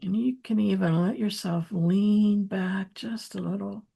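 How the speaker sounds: unhurried, softening meditation voice with long pauses; gentle grounding guidance, hushed by the end; guided meditation. Real, not acted.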